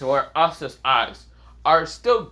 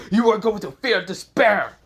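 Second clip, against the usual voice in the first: raised, higher villain menace; villain threat; 6 semitones higher, 3 dB louder than usual. Acted. Vector threatening the human runt, raised menacing voice.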